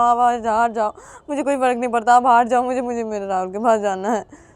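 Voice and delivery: fed up, just wants out; Ravi distraught. A narrator's reading. Ravi stammers to his sister that he must go to Rahul.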